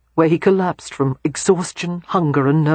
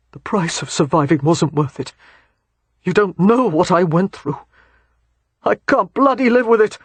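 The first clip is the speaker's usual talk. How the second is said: anguished; 2 semitones higher than usual. Acted. Bennett in anguish.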